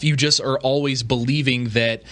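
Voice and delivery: ordinary talk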